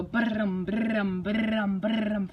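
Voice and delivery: mock engine revving with the lips; engine revving sound. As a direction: rumble like a starting snowblower. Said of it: "it should go".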